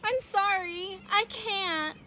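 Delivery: acted regret, words drawn out pitifully; sorry. Ricky apologizing to his friends, high plaintive voice.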